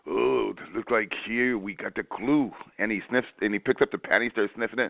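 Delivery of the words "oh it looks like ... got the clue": slow, mock French accent; hammed-up French detective accent. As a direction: drawl it out like a cartoon French sleuth. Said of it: "Can you imagine Detective Payon going".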